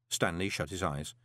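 ordinary delivery, nothing marked

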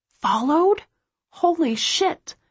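high, slow shocked gasp of a question; high startled alarm; shocked disbelief. A narrator's reading. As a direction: let the fear jump the voice up. Narrator's shocked inner thought.

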